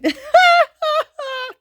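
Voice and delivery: high, loud shrieking laugh; burst of laughter. Real, not acted. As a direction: crack up at your own reflection. Laughing at herself in glasses.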